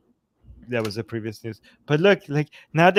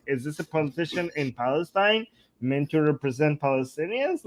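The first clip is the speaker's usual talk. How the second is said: ordinary talk, nothing marked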